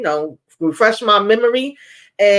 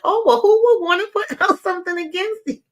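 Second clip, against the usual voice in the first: high-pitched mock innocence; sarcastic; 12 semitones higher, faster than usual. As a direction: feign innocent wonder, laughing through it. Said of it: "that was so sarcasm".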